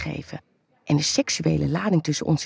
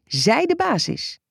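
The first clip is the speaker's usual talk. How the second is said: strict nurse; 9 semitones higher than usual. Acted.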